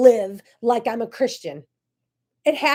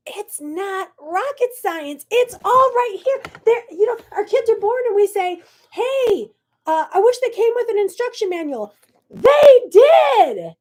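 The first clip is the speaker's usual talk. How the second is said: rising, louder preaching to the camera; fired up; 11 semitones higher, 7 dB louder than usual. Real, not acted. Preaching to viewers, building high and loud.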